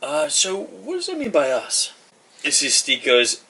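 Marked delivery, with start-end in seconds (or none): 0.0-1.9 much higher puzzled little voice; squeaky Poon. Acted. Poon questions the group, high puzzled voice. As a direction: pitch up as a small creature.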